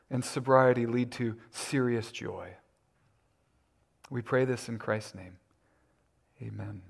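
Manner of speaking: hushed, measured prayer with long pauses. Real, not acted. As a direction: pray softly and reverently, letting the voice sink at phrase ends. Leads the congregation in prayer.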